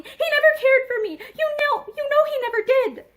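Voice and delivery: high, loud sobbing protest; sobbing despair. Acted. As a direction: cry it out desperately. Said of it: "her voice broken with sobbing".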